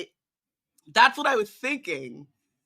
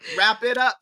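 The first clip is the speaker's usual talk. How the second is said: louder, through a laugh; laughing prod; 3 semitones higher, 7 dB louder than usual. Acted. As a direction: hurry them along while laughing. Acting William hurrying the ranter.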